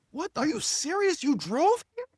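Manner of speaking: high-pitched disbelieving questions; incredulous. Retold or imitated. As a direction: re-enact stunned disbelief, pitch way up. Retells disbelief at her driving.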